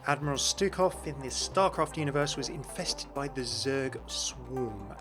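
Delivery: ordinary delivery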